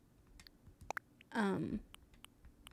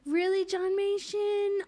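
surprised; 9 semitones higher, slower than usual. Real, not acted. Surprised by a donation.

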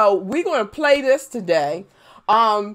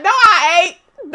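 slow, loud teasing denial; drawn-out joking protest; playful denial; 8 semitones higher, 8 dB louder, slower than usual. Real, not acted. Denying with a laugh.

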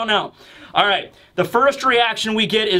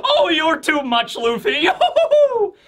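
elated, hammed-up high laughter; shrieking, hooting mock belly laugh; Brook; slower than usual. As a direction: whoop with laughter around the words. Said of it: "tearing up from laughing so much".

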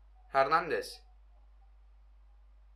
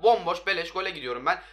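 ordinary talk, nothing marked

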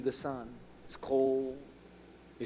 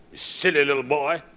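loud scornful put-down, acted out; imitated elder's loud rebuke; imitated scolding mentor; 7 dB louder than usual. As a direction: scold him loudly like an older mentor.

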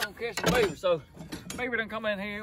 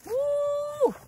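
high whoop; 3 dB louder than usual. Real, not acted. Whoops at beaver lure smell.

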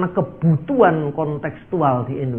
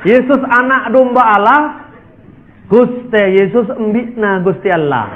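slow, Madurese-accented line; Madurese rendition; 3 semitones higher than usual. Shown as a demonstration. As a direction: say it like a Madurese believer. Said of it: "Orang Madura". (logat Madura, pelan)

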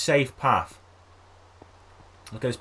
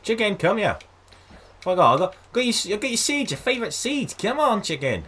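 coaxing pet voice; 10 semitones higher, 4 dB louder than usual. Real, not acted. Coaxing chicken with seeds.

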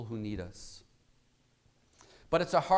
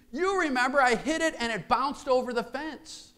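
put-on high, insistent child; high boyish plea; pleading boy; 11 semitones higher, 4 dB louder than usual. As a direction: push it up, the son near tears.

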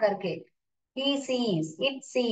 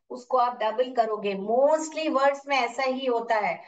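ordinary delivery

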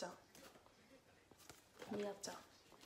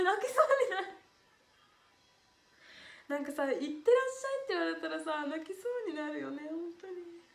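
higher, choked-up laughing near tears; moved almost to tears, laughing it off; close to tears; 6 semitones higher, 3 dB louder, faster than usual. Real, not acted.

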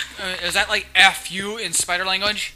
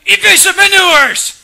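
high, shouted mock order; mock alarm call; 14 semitones higher, 13 dB louder than usual. Gamer voicing fleeing enemies, mock alarm call. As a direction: shout it like a panicking crew. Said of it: "They're like".

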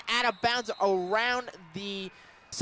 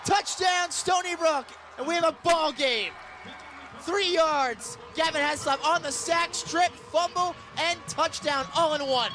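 excited shout; 7 semitones higher than usual. Real, not acted. Radio announcer shouting a fumble-return touchdown, thrilled shout. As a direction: let it rip as the fumble goes back for a score.

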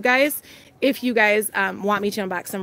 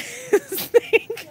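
laughing; 12 semitones higher than usual. Real, not acted. Giggling over the bunnies.

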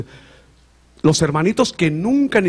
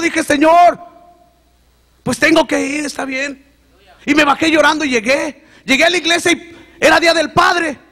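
high, loud, fervent testimony; rising, loud pulpit voice; preaching at full force; 11 semitones higher, 6 dB louder than usual. Acted. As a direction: preach it out to the congregation. Tearful climax of testimony preached.

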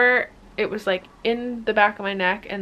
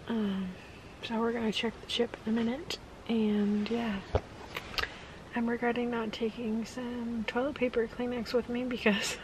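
quiet, hushed talk in a hunting blind; hushed whisper; 3 semitones higher, 9 dB quieter than usual. Real, not acted. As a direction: keep it hushed so the deer don't hear.

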